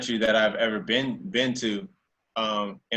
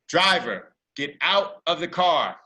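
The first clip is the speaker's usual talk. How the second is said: cop's command; 5 semitones higher than usual. Retold or imitated. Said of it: "next thing I hear is".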